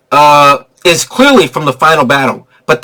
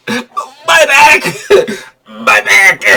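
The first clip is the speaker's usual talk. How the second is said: mock back groan; 6 semitones higher, much slower than usual. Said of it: "repeating the line".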